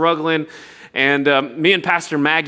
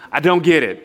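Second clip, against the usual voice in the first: ordinary delivery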